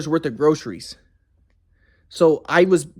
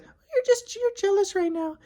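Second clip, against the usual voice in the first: high falsetto accusation; mocking his ex; 4 dB quieter, slower than usual. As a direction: sneer it in a high put-on voice. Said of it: "And she's like".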